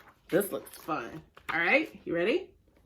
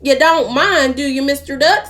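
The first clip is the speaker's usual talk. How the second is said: lightly voiced cow; 4 semitones higher, 6 dB louder than usual. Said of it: "said Cow as she plowed past".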